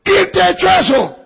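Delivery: high, loud accusing questions; father shouting accusations; angry questioning. Acted. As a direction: demand who did it. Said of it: "decía el hombre".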